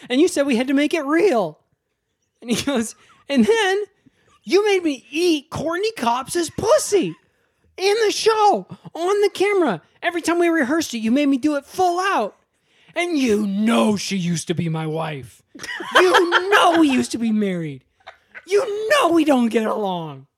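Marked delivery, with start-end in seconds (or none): 0.1-1.6 high, loud mock outrage; outraged accusation. Kyle ranting at the director, comic outrage. 3.3-20.3 hammed-up high, loud shouting rant; outraged tirade. Kyle berating the director over his ex-wife, comic fury.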